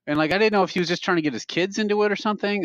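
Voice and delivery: usual speaking voice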